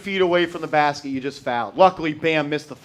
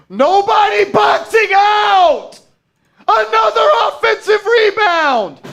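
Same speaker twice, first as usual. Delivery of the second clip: slow, high, loud outraged yell; furious shout; 8 dB louder, slower than usual. Real, not acted. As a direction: drag out the rage at the rebounds. Furious at another offensive rebound.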